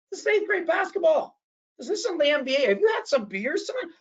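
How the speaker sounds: high-pitched retold indignation; indignant challenge. Telling off a rowdy dad in the bleachers, indignant challenge. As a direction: call him out, pitched well up. Retold confronting a disruptive parent.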